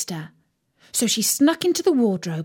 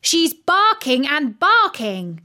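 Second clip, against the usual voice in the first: louder, slow scolding; mild shout; 6 semitones higher, 4 dB louder than usual. A narrator's reading. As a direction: mum scolding about the dog. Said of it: "shouted Mum".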